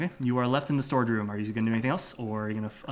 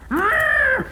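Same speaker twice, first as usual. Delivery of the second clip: loud, high scare roar; 9 dB louder than usual. Acted. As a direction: jump out and roar. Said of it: "get a scare out of you".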